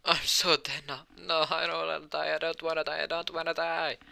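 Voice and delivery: high, strained panic without real volume; panicked mock scream. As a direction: whine it out like a terrified kid. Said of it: "Asir started to scream now".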